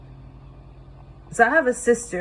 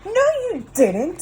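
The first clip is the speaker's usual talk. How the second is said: snapping; 10 semitones higher, 4 dB louder than usual. Acted. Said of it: "snapped Sam".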